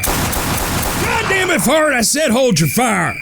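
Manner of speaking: high exasperated shout; angry yell. Acted. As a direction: scold the trigger-happy brother. Angry brother stops the shooting.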